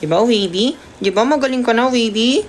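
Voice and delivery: high, sing-song pet voice. Real, not acted. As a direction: coo at the cat in a high voice. Talking to her cat.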